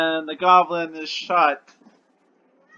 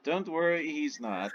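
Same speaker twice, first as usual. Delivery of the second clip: hushed, understated reply; quiet aside; 11 dB quieter than usual. Acted. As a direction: answer softly, just between the two of you. Lich's hushed answer to a leaned-over question.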